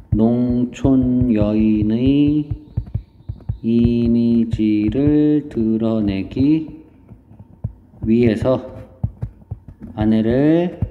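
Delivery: very slow, syllables stretched out; writing-along dictation. Real, not acted. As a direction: draw out each phrase while writing it down. Writes answer while saying it. (받아쓰듯 음절을 길게 늘여 천천히)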